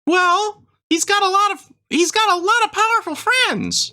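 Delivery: high squeaky Christmas elf; chirpy elf shopkeeper, way up high. Acted. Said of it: "I do an elf voice".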